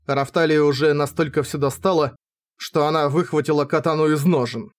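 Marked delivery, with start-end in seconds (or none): none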